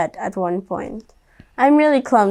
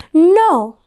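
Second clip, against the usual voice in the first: stubborn no; 6 semitones higher, 5 dB louder than usual. Acted. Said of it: "Stephanie said".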